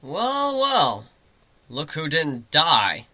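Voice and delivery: slow mocking drawl, well well; teasing. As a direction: needle the unicorn who just woke up. Teasing the thawed unicorn.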